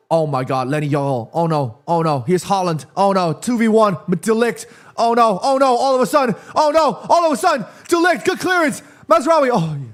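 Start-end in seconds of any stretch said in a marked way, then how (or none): 5.0-9.5 high, urgent match-watching alarm; mounting panic. Real, not acted. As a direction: panic as the danger builds, voice rising. Panicked live reaction to attack.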